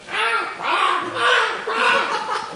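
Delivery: high, loud squawking eagle cry, laughing. Retold or imitated. The male eagle flying off, crying out high and loud. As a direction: crow like a proud bird. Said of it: "he's going".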